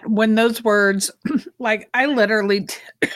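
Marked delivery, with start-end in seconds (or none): 2.7-3.2 coughing, choking on a nut. Real, not acted. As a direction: cough through a nut caught in throat. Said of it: "choking now on a nut".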